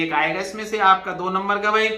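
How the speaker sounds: ordinary delivery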